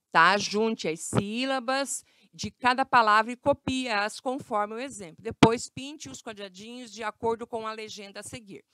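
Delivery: ordinary talk, nothing marked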